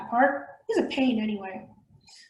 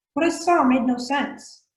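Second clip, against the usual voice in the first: ordinary delivery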